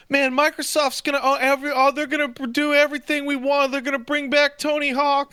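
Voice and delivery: high-pitched gullible fan gushing; mock a naive fanboy in a high voice; brain-dead fanboy. Co-host mocking fans hyped about Microsoft's buyout, gullible gushing. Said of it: "most brain dead fucking morons".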